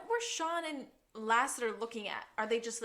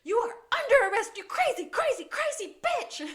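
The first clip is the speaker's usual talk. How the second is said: much higher, louder, hammed-up furious yell; mock enraged scream; 13 semitones higher, 7 dB louder than usual. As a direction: scream it like Juliet out of control, slowing at the end. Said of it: "what she screams".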